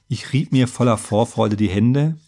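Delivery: usual speaking voice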